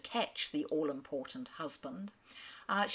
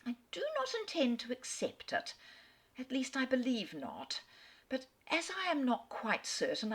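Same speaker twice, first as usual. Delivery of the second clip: put-on higher, breathless, giddy young lady pouring out her news; gushing young letter writer; 4 semitones higher, 5 dB louder than usual. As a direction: play a silly, excited girl torn over a marriage offer. Reads the heroine's letter aloud.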